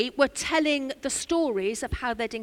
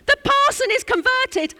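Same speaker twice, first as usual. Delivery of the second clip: ecstatic shout, much higher and louder; 12 semitones higher, 8 dB louder than usual. Retold or imitated. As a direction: shout it out with joy like a revival cry. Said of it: "he shouted out in Cornish fashion".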